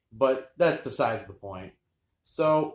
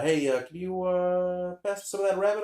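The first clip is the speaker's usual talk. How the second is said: hesitant request; 7 semitones higher than usual. Retold or imitated. Acted hesitant request at dinner.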